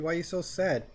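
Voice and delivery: ordinary talk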